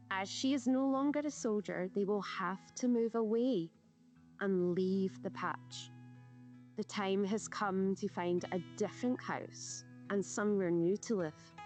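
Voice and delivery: plain reading voice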